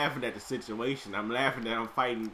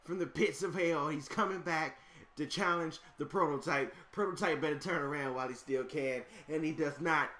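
hype announcer; 2 semitones higher, 4 dB quieter than usual. Acted. Announcing the opponent's return from hell, booming and dramatic. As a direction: sell the villain's arrival to the crowd.

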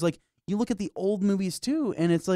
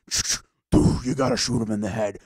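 hushed whisper, then low; 8 semitones lower than usual. Retold or imitated. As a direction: whisper urgent advice. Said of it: "They're just like".

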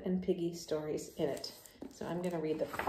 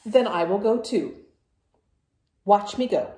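loud, insistent announcement; defiant; 7 dB louder than usual. Acted. Gerald tells Piggy he's going too, loud and defiant. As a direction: show him you're going too.

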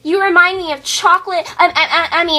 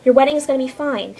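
normal talking voice

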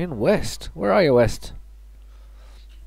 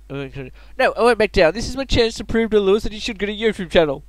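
high, loud resolve; determined kid hero; 7 semitones higher, 5 dB louder than usual. Acted. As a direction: stand firm like a young hero. Kid refuses to back down.

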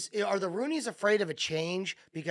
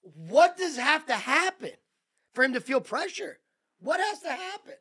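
exasperated demand; 9 semitones higher, 5 dB louder than usual. Real, not acted. Host demanding what has to happen for coach to feel pressure, exasperated. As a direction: press each word, demanding an answer.

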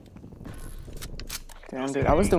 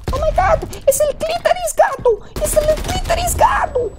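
high far put-on awed crowd voice; mock fans; 4 dB louder than usual. Imitating history-book fans gasping in awe. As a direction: squeal it like starstruck fans. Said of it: "They're gonna be like".

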